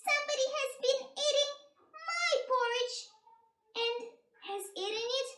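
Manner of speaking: put-on high, loud baby-bear wail; little baby bear voice. A narrator's reading. Baby bear finds his porridge eaten up, high loud cry. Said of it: "he cried in his little baby voice".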